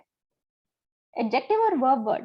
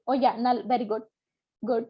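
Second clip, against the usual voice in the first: usual speaking voice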